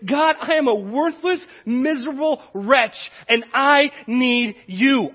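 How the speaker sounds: slowing, earnest confession to God; loud, pleading cry for help; pleading prayer. Retold or imitated. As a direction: plead it slowly, broken.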